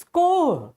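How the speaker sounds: core said slowly, P and S silent; correct pronunciation. Shown as a demonstration. Teacher demonstrates the word, slow core with silent endings. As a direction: stretch core, dropping P and S. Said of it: "both letters are silent".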